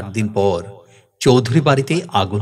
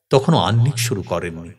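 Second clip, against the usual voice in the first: plain reading voice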